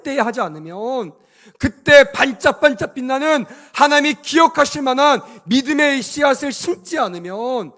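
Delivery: loud, rising preacher's exhortation; forceful preaching. Real, not acted. Preacher urging the congregation to bear fruit, forceful. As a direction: push each phrase out to the congregation.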